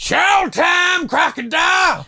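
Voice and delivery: high, gleeful yell announcing the show; gleeful shout. Acted. Joe cheering as he unleashes the croc, high gleeful shout. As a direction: bellow it out like a showman unleashing the beast.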